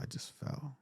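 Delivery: whispered, almost voiceless; hushed dad voice. Retold or imitated. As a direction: let the words drop to a breath. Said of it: "that little small tone".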